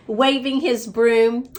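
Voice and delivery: plain reading voice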